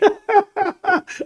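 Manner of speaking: a shrill, teasing cackle way up high; high mocking laugh.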